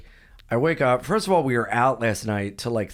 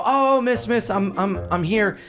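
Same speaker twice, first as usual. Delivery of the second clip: higher-pitched, hurried attempt to get her attention; urgent plea; 8 semitones higher than usual. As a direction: call out to stop her politely. Retold plea to ticket officer.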